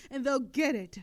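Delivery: loud incredulous jab; exasperated outburst. Acted. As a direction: throw up your hands at the naivety.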